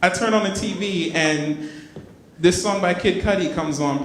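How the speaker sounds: ordinary talk, nothing marked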